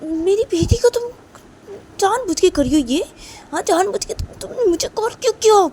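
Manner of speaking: high angry yelling mother; furious shout. Acted. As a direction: lash out at the caller, shouting.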